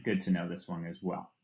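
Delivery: usual speaking voice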